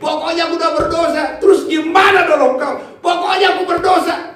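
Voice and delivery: hammered-up stubborn pleading, pitched high; insistent shout. Retold or imitated. Orang berdosa ngotot kepada Tuhan. (teriakan ngotot, nada tinggi)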